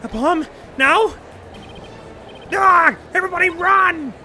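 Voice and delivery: high, loud panicked cries; panicked screaming. Acted. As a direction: scream in terror at the bomb. Courtroom panics at bomb.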